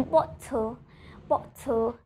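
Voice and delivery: slow "bottle" with a forceful t; "bottle" with a true pressed t in the middle; T with pressure. Shown as a demonstration. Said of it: "I'm pronouncing letter T with pressure".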